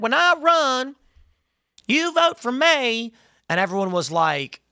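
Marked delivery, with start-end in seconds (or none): 0.0-3.1 high, slow put-on Southern drawl; exaggerated Southern twang, pitched up and drawn out; mock Hillary Southern accent. Candidate courting votes in Arkansas, in a mock Southern accent. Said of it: "she talked like this".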